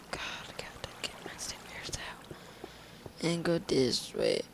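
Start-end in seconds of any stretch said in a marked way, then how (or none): 0.1-2.3 whispered under breath; whispering. Real, not acted. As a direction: whisper it to yourself. Repeats line then whispers it.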